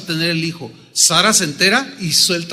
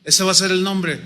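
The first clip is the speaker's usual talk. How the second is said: usual speaking voice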